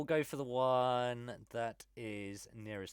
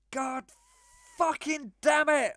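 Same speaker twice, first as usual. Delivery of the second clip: loud, high in-character outburst; exasperated shouted curse, drawn out; frustrated yell; 13 dB louder, slower than usual. Acted. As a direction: yell it, fed up and at the end of your tether. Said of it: "And I just yell".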